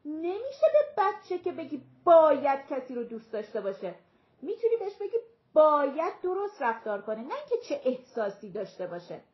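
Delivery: high, forceful retort; loud, emphatic acted rebuttal. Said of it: "فرانسیس با صدای بلند گفت".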